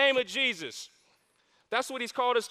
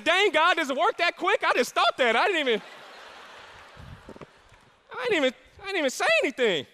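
excited disbelief; 6 semitones higher, faster than usual. Retold or imitated. Excited retelling of surprise.

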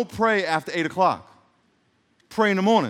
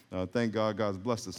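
normal talking voice

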